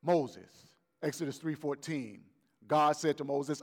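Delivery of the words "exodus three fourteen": ordinary delivery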